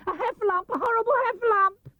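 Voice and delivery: very high panicked squeal; terrified Piglet. Acted. Piglet fleeing the heffalump, high panicked cry. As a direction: shriek for help in terror. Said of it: "cried Piglet".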